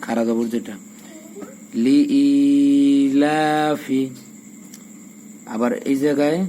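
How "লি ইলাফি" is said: লি ইলাফি with the ই and the লা stretched very slowly; sing-drawn লি ইলাফি, long ই and long লা; madd lengthening. Shown as a demonstration. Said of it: "এই যে হামজা একালিপ টান, লামে একালিপ টান". (লি ইলাফি হামজা ও লামে টান)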